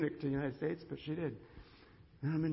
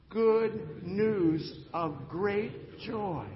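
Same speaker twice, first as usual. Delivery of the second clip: very slow, louder, leading the room; drawn-out call to repeat; 5 semitones higher, 6 dB louder, much slower than usual. Shown as a demonstration. Pastor leads the congregation in repeating, drawn out. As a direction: stretch every word for the crowd to follow. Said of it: "Would you say that with me?".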